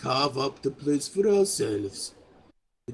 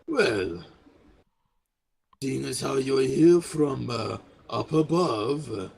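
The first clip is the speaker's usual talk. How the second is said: lightly voiced, deliberate game character with pauses; scheming Gazgrim. Game master voices Gazgrim asking players.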